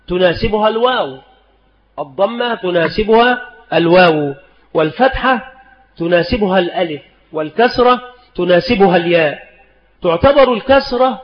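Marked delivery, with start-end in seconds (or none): none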